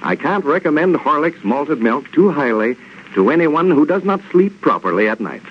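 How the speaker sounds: ordinary talk, nothing marked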